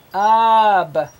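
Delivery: TUB split into a lone t, then ub; sounded out. Shown as a demonstration. Teacher prompts a child with the first letter, sounding it out. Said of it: "what starts with T?".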